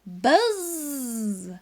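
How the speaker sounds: high buzzy call of a little fly; a fly calling its owner's name; fly's buzzing call. Acted. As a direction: buzz the name like an insect. Fly character calls out.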